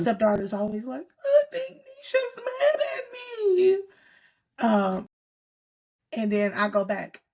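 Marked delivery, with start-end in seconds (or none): 1.2-3.8 put-on high, slow whimpering child voice; whiny stepdaughter. Stepdaughter fretting to her dad, whiny high child voice. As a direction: mimic a kid fretting that Nisha is mad.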